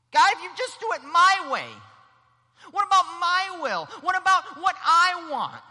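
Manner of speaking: high, loud tantrum shout; sulky protest. Acted. Believer mad at what God is doing, shouting petulantly. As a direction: demand it like a stubborn child. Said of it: "folds my arms and says".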